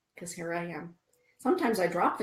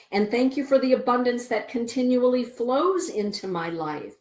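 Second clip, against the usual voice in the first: much louder and higher, building praise; fervent thanks; 5 semitones higher, 9 dB louder than usual. Shown as a demonstration. Modelling a thankful prayer for viewers, loud fervent gratitude. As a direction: pour out gratitude. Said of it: "We say".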